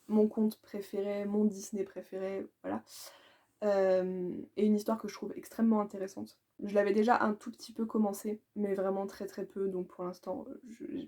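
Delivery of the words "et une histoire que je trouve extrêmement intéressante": normal talking voice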